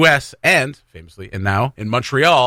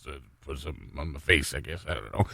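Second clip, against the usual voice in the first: mumbling; 11 semitones lower, 15 dB quieter than usual. Retold or imitated. Mumbled clueless costume answer.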